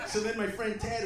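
normal talking voice

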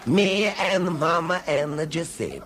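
put-on broad comic Italian accent, each word leaned on; Italian immigrant accent. New migrant from Ethnia describing his first footy, broad Italian accent. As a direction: play a fresh Italian arrival, all vowels open. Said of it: "this would be how he would describe".

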